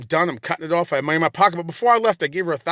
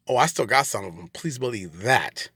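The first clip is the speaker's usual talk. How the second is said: lower, slowing to a drawn-out finish; low knowing assurance; 5 semitones lower, 4 dB quieter than usual. Real, not acted. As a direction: drop low and promise it slowly. Repeats it as a warning.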